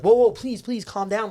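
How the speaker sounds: high, loud pleading; urgent plea to crowd. Retold or imitated. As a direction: call over a laughing crowd, begging them to settle. Recounts calming a roaring crowd.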